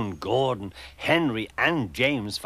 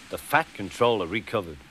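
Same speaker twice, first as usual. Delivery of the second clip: ordinary delivery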